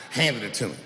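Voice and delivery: ordinary talk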